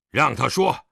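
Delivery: loud, curt order from an angry father; hard, commanding interjection; stern command. Acted. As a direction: cut in forcefully.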